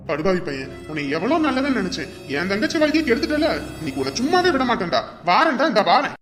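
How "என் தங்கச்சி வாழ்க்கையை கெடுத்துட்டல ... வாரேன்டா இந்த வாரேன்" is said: higher, louder furious threat; hammed-up fury; angry threat. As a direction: vow revenge on the boy. Brother enraged at sister's betrayer.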